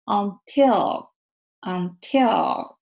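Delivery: "until" ending with tongue held behind upper teeth; final dark L. Shown as a demonstration. Teacher demonstrating word endings, held final L. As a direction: let "until" end in a dark "う"-like L. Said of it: "ベロの形がこの状態で終わる".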